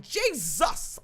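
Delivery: usual speaking voice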